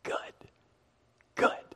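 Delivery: hushed, gloating devil voice dropping to a whisper; a quiet, sly Satan plotting in the dark; sinister Satan whisper. Acted. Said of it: "And Satan goes".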